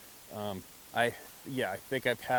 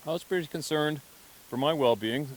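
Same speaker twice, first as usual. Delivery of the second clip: dry understatement; 5 semitones higher, 6 dB louder than usual. Retold or imitated. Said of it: "He would not say he was scared".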